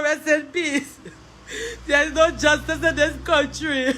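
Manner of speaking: high, keening mournful lament; wailing grief. Real, not acted. Grieving sister laments her shot brother, wailing. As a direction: pour out grief for a murdered brother.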